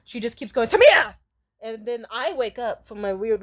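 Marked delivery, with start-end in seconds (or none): 0.7-1.1 loud high call of a name; mock shout of a name. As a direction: yell the name to wake her. Mom calling her awake.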